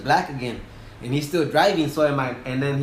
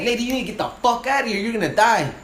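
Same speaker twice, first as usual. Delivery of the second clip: much higher, fast, forceful warning; urgent yell; 7 semitones higher, 3 dB louder than usual. Retold or imitated. Captain orders the lady out before the fire hits, urgent. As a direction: scare her into leaving.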